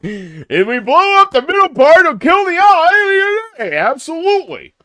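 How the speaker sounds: high, loud shout, drawn out; shouting movie hero in a storm; screaming action hero. Acted. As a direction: yell the plan over the tornado. Acting out tornado movie scene.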